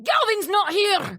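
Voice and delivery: high-pitched Scottish shopkeeper brush-off; Scottish accent. Retold or imitated. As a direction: imitate a curt Scottish shop worker. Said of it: "do that again".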